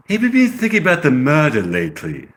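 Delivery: put-on amateur British accent. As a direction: ask in a careful English accent. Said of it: "I get the British accent".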